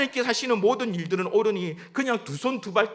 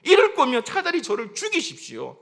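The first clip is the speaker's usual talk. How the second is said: high, heated outburst at God; resentful complaint; 8 semitones higher, 5 dB louder than usual. Acted. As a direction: protest bitterly as the aggrieved prophet. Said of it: "하나님 앞에 원망합니다".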